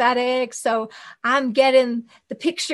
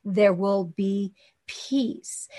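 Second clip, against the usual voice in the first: slow calm assurance; 3 semitones lower, 4 dB quieter, much slower than usual. Shown as a demonstration. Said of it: "the more you sit with it".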